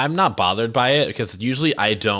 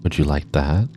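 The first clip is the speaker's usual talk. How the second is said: right in your ear; 4 semitones lower than usual. Acted. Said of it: "in your ear? Up close and personal?".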